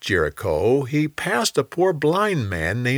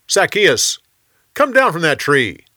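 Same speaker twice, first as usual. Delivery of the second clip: calling up to a man in a tree, raised voice; 6 semitones higher, 5 dB louder than usual. A narrator's reading. Jesus calls up to Zacchaeus in the tree, raised voice. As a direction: call him down from the branches.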